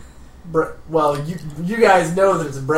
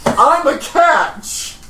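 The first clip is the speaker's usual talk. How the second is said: high, slow, loud preening boast; vain boast; 11 semitones higher, 7 dB louder, much slower than usual. Acted. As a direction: proclaim it to the wind, drawn out. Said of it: "This is the story he tells the wind".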